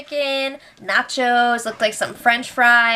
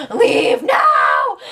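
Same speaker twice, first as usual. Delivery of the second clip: higher, louder mock yell; mock shout; 8 semitones higher, 4 dB louder than usual. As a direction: bark it at her to get out. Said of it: "they were just like".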